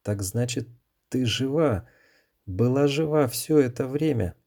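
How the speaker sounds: rising, stunned disbelief. A narrator's reading. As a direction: ask it as if seeing a ghost. Son sees mother alive.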